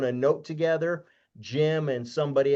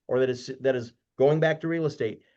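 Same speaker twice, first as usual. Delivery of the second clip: normal talking voice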